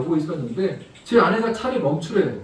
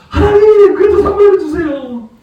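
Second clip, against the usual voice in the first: put-on much higher, louder childish begging; hammed-up wailing plea, pitched way up; whiny pleading prayer; 10 semitones higher, 7 dB louder than usual. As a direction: whine at God like a stubborn child. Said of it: "너는 왜 거기서 울고만 있냐".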